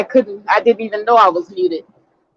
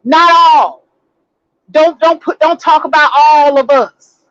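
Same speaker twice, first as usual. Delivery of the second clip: raised, mildly loud mock protest; mock hollering; 4 semitones higher, 4 dB louder than usual. Host mimicking men who come running up, mock hollering. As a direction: imitate indignant men hollering, pitched up. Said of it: "come running up, hollering".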